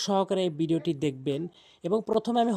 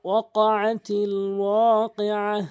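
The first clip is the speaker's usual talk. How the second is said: slow recitation; 2 semitones higher, 4 dB louder, slower than usual. Shown as a demonstration. Teacher showing students the verse, slow chant. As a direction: stretch the Arabic verse slowly.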